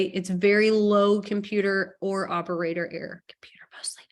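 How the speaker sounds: conspiratorial whisper; teasing whispered confession; whispered aside. Real, not acted. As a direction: lean in and whisper the joke. Joking aside about user error.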